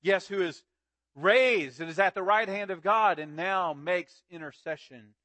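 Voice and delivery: loud, ringing preacher's proclamation; preaching at full force. Acted. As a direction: drive it home from the pulpit. Preaching climax before the story.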